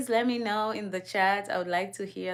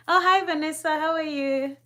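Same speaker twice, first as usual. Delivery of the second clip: excited greeting; 8 semitones higher, 5 dB louder than usual. Real, not acted. Greeting a viewer who just joined.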